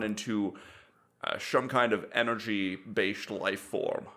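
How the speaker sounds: slow Connery-style Scottish drawl; lightly voiced Sean Connery; Scottish accent. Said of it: "he's like".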